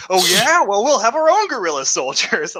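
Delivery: loud, high mock bravado; boastful one-upping. Americans trying to one up the Soviets, boastful. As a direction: fire back with competitive bluster.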